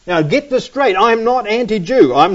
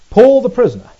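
loud, higher PAUL, falling away through prisoner; emphatic proclamation; 5 semitones higher, 8 dB louder, faster than usual. Shown as a demonstration. Preacher repeats Paul's title to the congregation, emphatic proclamation. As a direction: punch out the title without stretching it.

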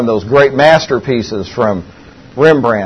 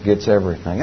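soft and solemn; 5 semitones lower, 7 dB quieter than usual. Retold or imitated. Punchline delivered softly.

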